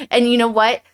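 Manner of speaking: usual speaking voice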